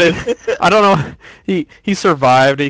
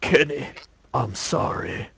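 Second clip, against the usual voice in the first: soft, lower mock-solemn apology; hushed apology; 4 semitones lower, 8 dB quieter than usual. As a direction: murmur a playacted apology. Mock apology before mercy killing.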